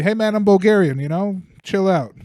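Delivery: firm retold telling-off. Barista retelling how he warned the drunk Russian, loud firm voice. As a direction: tell him to back off, raising the voice.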